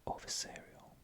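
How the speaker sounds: ordinary delivery, nothing marked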